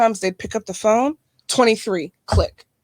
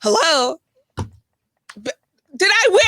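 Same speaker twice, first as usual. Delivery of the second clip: mock caller hello; 6 semitones higher, slower than usual. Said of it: "why did she pick up".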